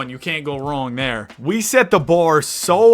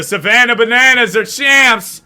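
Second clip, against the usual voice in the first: higher, louder championship call; hype announcer; 7 semitones higher, 7 dB louder than usual. Acted. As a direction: announce the win like a broadcaster.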